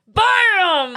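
high, sudden "Bam!"; mock scare shout. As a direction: pounce on it to startle someone. Acting out jumping out to scare.

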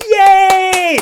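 loud, high, drawn-out cheer; maniacal cheering. Retold or imitated. Counselor and girl greeting the thunder, loud cheering. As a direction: cheer like a thrilled kid at full lift. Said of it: "cheering, like, maniacal".

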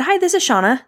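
high, chirpy phone-answering voice; phone greeting. Shown as a demonstration. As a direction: pick up with a high, lilting hello. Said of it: "This is how I answer my phone".

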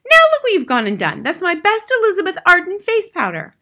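high, loud scolding; mother shrieking. A narrator's reading. Mother scolds husband over spilled face powder, shrieking. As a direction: scold shrilly over the waste. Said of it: "shrieked the mother".

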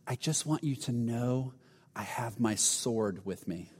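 low, hushed near-whisper, drawn out; whispered menace, imitated. Vampire-group man to Tim behind his back, mock menacing whisper. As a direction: lean in quietly, slow and low. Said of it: "he kind of whispers to him".

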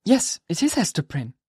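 answer, lightly startled; surprised reply. Acted. Hester answering the minister, mildly surprised. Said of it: "she replied in a tone of surprise".

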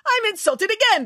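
very high, affronted director voice; pompous high-pitched outrage; indignant declaration. Acted. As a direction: declare yourself mortally offended. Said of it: "declared the director".

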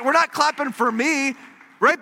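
ordinary talk, nothing marked

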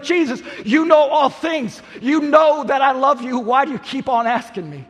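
loud, exasperated protest pitched high; frustrated. Acted. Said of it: "gets a little bit frustrated".

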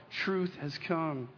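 hushed, weighty slow statement; slow solemn declaration. Acted. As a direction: drop to a soft, very slow proclamation. Repeats key sermon line.

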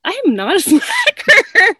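high-pitched protest cracking into a laugh; laughing mock indignation. Hairdresser who decorated the salon alone, laughing mock indignation. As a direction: protest the insult, then laugh at it.

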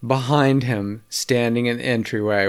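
plain reading voice

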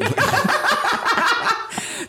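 high, long burst of laughter; high-pitched helpless laughing; real laughter. Real, not acted. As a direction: crack up after the joke lands. Cracks up at own joke.

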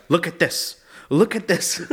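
mock-urgent mom voice. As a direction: act out a mother urgently pushing a prayer on her child. Acting out mother's frantic exorcism.

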